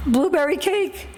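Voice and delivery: high, tearful sobbing mock voice; imitating a sob. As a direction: choke out the words like a sobbing boy. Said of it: "he goes exactly like this".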